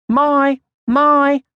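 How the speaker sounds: high, loud calling out; yelled. A narrator's reading. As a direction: shout for the little sister across the garden. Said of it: "she yelled".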